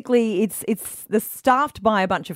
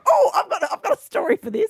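eager chatter; 11 semitones higher, faster than usual. Retold or imitated. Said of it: "you just go".

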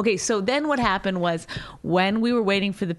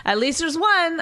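higher, mock needling repeat; 8 semitones higher than usual. Wife mimics husband poking at the clerk, needling. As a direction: imitate him poking away with the jab. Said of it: "poke, poke, poke".

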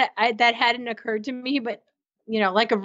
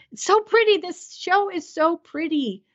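gushing; 8 semitones higher than usual. Real, not acted. Podcaster raving about a pretty show, gushing. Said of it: "I'm done gushing".

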